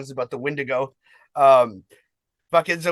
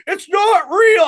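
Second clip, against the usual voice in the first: high, loud mocking yell; mock shout; 8 dB louder, much slower than usual. Drummer yelling at the crowd, high mock shout. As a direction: yell it out in disbelief. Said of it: "my drummer's like".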